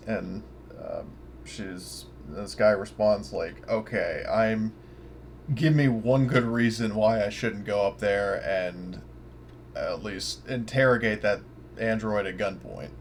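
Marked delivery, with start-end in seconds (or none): none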